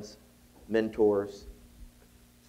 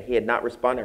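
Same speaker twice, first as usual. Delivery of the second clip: normal talking voice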